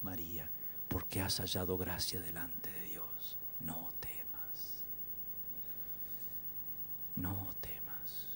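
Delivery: low, very slow near-whisper; hushed reassurance. Acted. God telling Mary not to fear, hushed, slow reassurance. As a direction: let each phrase sink in, soft and drawn out. Repeating God's reassurance meditatively.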